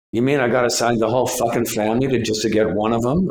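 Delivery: fast, gravelly New York agent; gravelly New York accent. Retold or imitated. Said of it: "she talked like this with a gravelly voice from New York".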